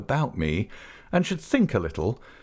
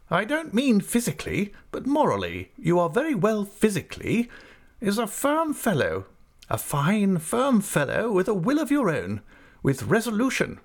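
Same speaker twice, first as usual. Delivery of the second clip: brisk, much higher voice of a stern aunt; 11 semitones higher than usual. Acted. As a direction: play the decisive old aunt. Aunt lectures, voiced high.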